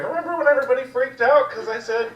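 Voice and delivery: high, fast imitation of mom; mom's voice. Comic imitating mom bringing up an old incident, high quick voice. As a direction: chatter it quickly, pitched well up.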